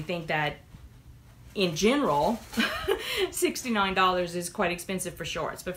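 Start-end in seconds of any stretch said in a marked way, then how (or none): 2.4-3.2 burst of high laughter. Real, not acted. Laughing at own opinion.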